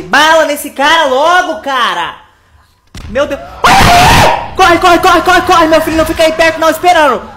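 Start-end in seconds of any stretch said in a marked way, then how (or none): 3.6-4.5 sudden high cry of fright; startled shriek. Real, not acted. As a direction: yelp in real fright. Susto no jogo. (gritinho de susto)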